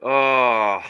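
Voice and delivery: loud pained oh at the screen; anguished groan. Real, not acted. Fan groaning at an interception, loud and pained. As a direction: groan out the bad play.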